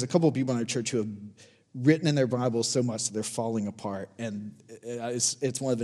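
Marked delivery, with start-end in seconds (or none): none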